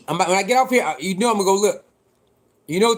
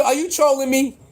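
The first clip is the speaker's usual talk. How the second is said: usual speaking voice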